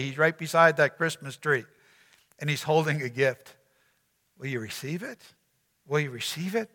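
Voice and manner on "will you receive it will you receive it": intimate invitation. Real, not acted.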